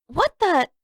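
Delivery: high, loud, sudden alarm; a jolt of surprise as the bison stops; startled. Acted. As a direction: cut off in shock. Appa lurches to a halt.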